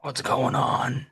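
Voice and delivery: slow impression that comes out like Batman; drawn-out put-on Batman-style greeting; Dawson impression. Co-host imitating an absent colleague on being introduced, Batman-like impression. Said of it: "a little bit more Batman than it was Dawson".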